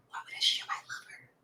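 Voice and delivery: hushed question; whisper. Real, not acted. Whispering so the child in the room won't hear. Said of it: "I'm whispering because there's a child".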